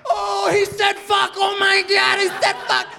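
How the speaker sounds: very high, loud excited shrieking; scandalized kids. Retold or imitated. Kids react to the comedian swearing, mock shrieks. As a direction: imitate kids losing their minds, slowed and squealing.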